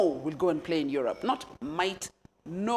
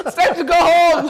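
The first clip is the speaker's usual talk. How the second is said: louder and higher, laughing; 9 semitones higher, 12 dB louder than usual. Real, not acted. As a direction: laugh the words out. Laughing at her own story.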